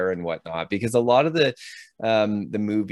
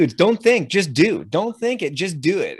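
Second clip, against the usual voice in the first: higher, louder drill chant; barked credo; 9 semitones higher, 4 dB louder than usual. Retold or imitated. Recruits repeat the army credo, high and loud. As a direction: bark it like a recruit motto.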